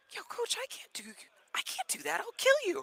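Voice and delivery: very high-pitched mock panic; alarmed protest. Another player asked to lift the coach, alarmed protest. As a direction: imitate a balking player in a squeaky high voice.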